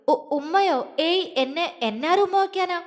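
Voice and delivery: put-on a flustered girl who stumbles over her words; flustered stammer. A narrator's reading. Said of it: "പതർച്ചയോട് അവള് ചോദിച്ചു". (പതർച്ചയോടെ, വിക്കി വിക്കി)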